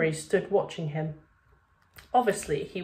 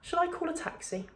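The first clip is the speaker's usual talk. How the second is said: much higher, softer shocked question; 11 semitones higher, 6 dB quieter than usual. A narrator's reading. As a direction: ask it as if caught off guard. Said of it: "in a shocked tone".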